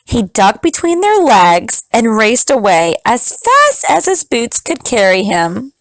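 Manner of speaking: usual speaking voice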